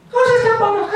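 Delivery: very high puzzled squeak; high squeaky voice. Acted. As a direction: ask it in a tiny high voice. Two voices in mock dialogue.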